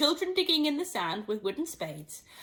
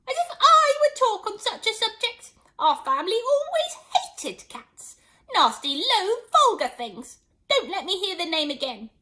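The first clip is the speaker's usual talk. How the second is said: much higher, louder outraged squeak; trembling indignant mouse; 11 semitones higher, 7 dB louder than usual. Acted. Mouse furious at talk of cats. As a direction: spit out the scorn. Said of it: "who was trembling".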